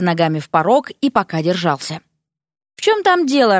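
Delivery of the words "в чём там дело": higher, mildly grumpy query; disgruntled and sleepy. A narrator's reading. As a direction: ask as if just woken. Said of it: "недовольный сонный голос". (недовольно, чуть сонно)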